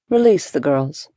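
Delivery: mild voice with steel underneath; understated, controlled threat; deceptively mild. A narrator's reading. Musketeer orders kidnappers to free girls, deceptively mild. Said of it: "his voice deceptively mild but for the steel".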